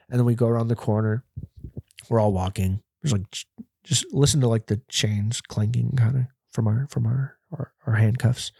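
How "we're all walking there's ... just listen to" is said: ordinary delivery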